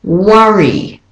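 British worry, short cup vowel; Australian and British worry. Shown as a demonstration. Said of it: "So in the Australian and British, it said".

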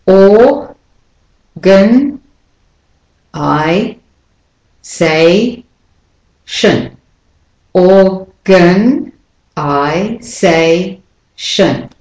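each syllable isolated with a pause; slow syllable by syllable. Shown as a demonstration. Teacher asking learners to pick stresses, slow separated syllables. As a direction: say it slowly in separate pieces, stressed and de-stressed. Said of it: "as I say it slowly".

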